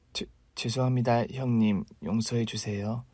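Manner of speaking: wife begging forgiveness, fading; frightened plea. Acted. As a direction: plead in a fading, shaky voice.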